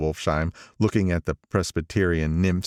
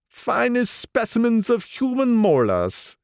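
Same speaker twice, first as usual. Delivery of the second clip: put-on much higher, proud old racketeer; old gangster voice. Old gangster praising Gatsby to the narrator, higher proud voice. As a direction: boast about him, pitched up. Wolfsheim shows cufflinks.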